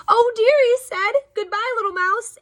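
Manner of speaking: high, loud squeak of dismay; mock fright. Snake fleeing the Gruffalo, high mock-frightened squeal. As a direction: flee with a squeaky hasty farewell.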